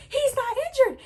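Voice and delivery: high, loud: not injured; shocked exclaim. Retold or imitated. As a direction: cry out He's not injured in disbelief. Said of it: "I said".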